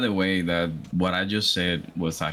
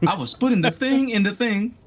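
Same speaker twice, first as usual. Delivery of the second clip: frantic quote; 14 semitones higher, 4 dB louder than usual. Retold or imitated. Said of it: "I'm like".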